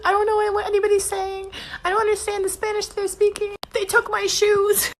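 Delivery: high, mock-distressed prisoner voice; pretend panic. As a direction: fake being scared and lost in a jail cell. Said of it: "I even said".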